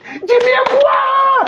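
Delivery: high, drawn-out holler; calling out. Acted. Host breaks off the story, calling out to Jimmy Ekwa. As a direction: holler the name high and stretch it.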